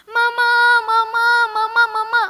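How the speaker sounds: high, loud, wailing call; crying shout. Acted. Sister running to find Mama, wailing shout. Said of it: "sambil teriak-teriak dan menangis".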